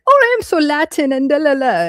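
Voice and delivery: high, put-on Latin accent, mocking; fake Sofia Vergara. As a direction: do a bad Latin diva accent. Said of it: "fake Sofia Vergara".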